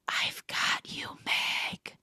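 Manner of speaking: soft whispered memory, slow. A narrator's reading. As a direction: breathe it like a distant memory. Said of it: "we hear this whisper, almost like a memory".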